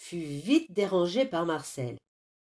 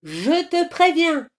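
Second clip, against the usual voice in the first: loud, high-pitched warning; irate old man's threat; threatening; 9 semitones higher, 7 dB louder than usual. A narrator's reading. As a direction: threaten a naughty child, voice raised. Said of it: "à menacer Marcel".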